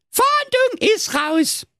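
very high, slow shouted call. Acted. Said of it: "rief das Blaukäppchen".

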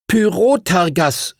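a high, slow, ringing call. Acted. Boy calls his dragon's new name, high and slow. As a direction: sound out the name grandly. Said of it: "rief Arthur".

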